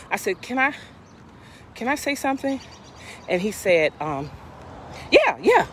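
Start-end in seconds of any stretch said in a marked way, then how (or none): 0.4-2.6 polite. Retold or imitated. Polite request to the officer. 5.1-5.7 officer. Retold or imitated. Officer's quick reply.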